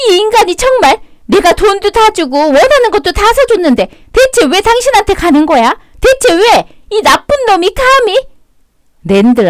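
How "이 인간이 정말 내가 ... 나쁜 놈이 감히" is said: high, outraged wife's rant; a betrayed wife's high, fuming outburst; furious wife. Acted. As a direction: spit it out in disbelief at him. Wife furious at cheating husband.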